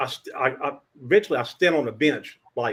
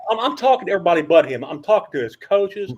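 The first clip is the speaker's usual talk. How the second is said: ordinary talk, nothing marked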